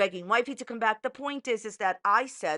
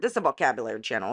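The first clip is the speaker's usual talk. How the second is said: quick lower aside; 9 semitones lower, much faster than usual. Acted. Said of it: "running joke aside".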